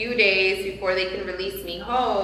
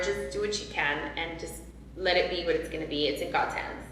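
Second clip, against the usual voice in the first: softer, lower retold surrender; quiet resignation; 3 semitones lower, 7 dB quieter, faster than usual. Mother hands the decision to the doctor, quiet resignation. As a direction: let it sink quietly, resigned.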